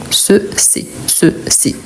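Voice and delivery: soft, alternating "Ce" and "c'est" to contrast the vowels. Shown as a demonstration. Teacher shows what changes in pronunciation, "Ce" against "c'est". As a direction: set rounded "Ce" against open-e "c'est", twice. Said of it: "Qu'est-ce qui change? La prononciation".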